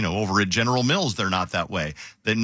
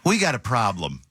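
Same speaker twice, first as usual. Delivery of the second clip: raised hello, then a long weary sigh; Rob; 5 semitones higher than usual. Retold or imitated. Host imitates boss starting every phone call, exasperated. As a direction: imitate a boss sighing before bad news. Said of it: "he would start every phone call with this".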